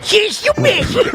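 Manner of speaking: put-on high, slow old man call; old man yell. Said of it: "imitating the old man".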